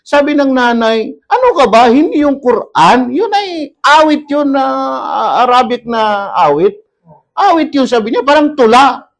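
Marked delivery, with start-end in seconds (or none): 1.3-7.9 mother's scolding. Retold or imitated. Mother scolds father, retold.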